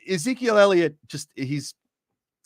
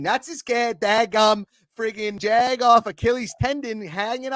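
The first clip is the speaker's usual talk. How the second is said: hammed-up Pittsburgh accent, pitched higher throughout; thick Pittsburgh accent, pitched higher, cocky and fired up; 4 semitones higher than usual. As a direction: rant like a riled-up Steelers fan in a thick Pittsburgh accent. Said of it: "but in a Pittsburgh accent?".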